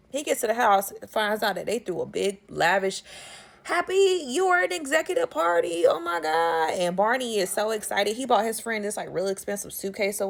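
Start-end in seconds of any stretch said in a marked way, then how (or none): 3.6-6.7 sung out much higher in celebration; party cheer. Retold or imitated. Guests greeting Fred at his party, cheering high. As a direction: shout it like a surprise party greeting.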